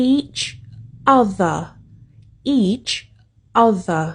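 "each" then "other", separated by pauses; slow each other. Shown as a demonstration. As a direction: say "each" and "other" slowly, one at a time. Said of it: "มันออกเสียงว่า". (ออกเสียง each other ช้าๆ แยกคำ)

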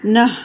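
नह with a clear breathy ह; aspirated nh. Shown as a demonstration. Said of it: "उसके बाद है". (नह में ह की आवाज़)

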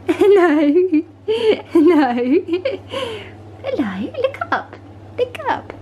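high sing-song doggy voice. Real, not acted. Calling the puppy to look.

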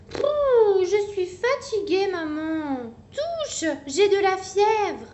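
put-on high, complaining child voice; a tired child pleading; whiny little koala. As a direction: play the sick little one whining to mum. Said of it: "dit-elle en prenant sa main".

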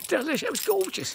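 swooning food praise, voice shooting up; raving about food. Real, not acted. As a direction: gush over the tasty risotto. Raving about last night's risotto.

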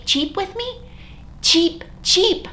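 high chirpy cheep; tiny bird chirping. Shown as a demonstration.

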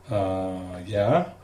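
drawn-out uh, then a questioning yeah; puzzled, hesitant reply; hesitant answer. Retold or imitated. Theater guy answers a blunt phone question, hesitant. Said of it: "And he goes".

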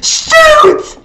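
loud, very high squealed SHOOT; high-pitched excited shriek; laughing shout. Real, not acted. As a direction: yell it out with a laugh. Repeats the word as shout.

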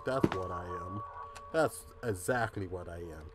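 self-deprecating mutter. Real, not acted. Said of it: "'Cause I'm a goddamn idiot".

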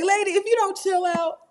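playful high-pitched mock scold; mock exasperated lady. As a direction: squeal it like a fed-up big sister. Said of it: "I be like".

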